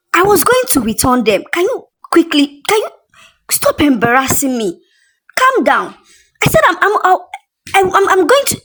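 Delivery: high, panicky stammering pleas; flustered stammer. Acted. Tasha pleading with the angry brand owner, stammering. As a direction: beg her to calm down, tripping over words. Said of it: "she stammered".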